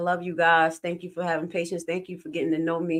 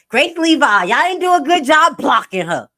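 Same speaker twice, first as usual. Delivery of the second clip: mock Tiffany Henry; 9 semitones higher, 11 dB louder than usual. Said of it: "talking about".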